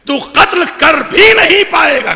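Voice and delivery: put-on young man shouting that Hajjaj cannot kill him; defiant shout. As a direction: throw the defiance back at Hajjaj. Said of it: "توجہ دیدان گرامی".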